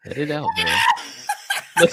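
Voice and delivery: high laughing shout, slow. Real, not acted. Egging on Vera as she laughs, in a high laughing shout. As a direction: cackle out the name through laughter.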